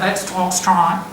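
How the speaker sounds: slow broad Australian drawl, Strine through the nose; nasal Australian accent. Shown as a demonstration. Teacher repeating the nasal Australian demo. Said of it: "You've got to say it through your nose like that".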